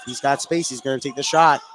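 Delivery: ordinary talk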